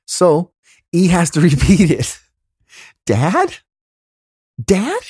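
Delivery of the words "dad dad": much higher-pitched imitation of a son; pleading kid. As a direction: repeat the plea to Dad, high and insistent. Son repeats plea to dad.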